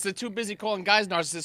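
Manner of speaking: normal talking voice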